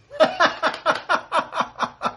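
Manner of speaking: a high airy real laugh with no voice; breathy laugh. Real, not acted. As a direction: laugh out breathily at the end of the tale. Laughs at the crossed T.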